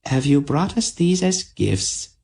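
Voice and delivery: high, teasing queen's voice; dryad queen. A narrator's reading. Queen Xantha to Aunt Paul, a high playful voice. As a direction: admire the men playfully.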